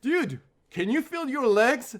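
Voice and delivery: high, loud, drawn-out mock alarm; hammed-up shout, slow and high; retold alarm shout. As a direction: imitate a friend yelling an alarming question. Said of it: "Lindsay, without hesitation, goes".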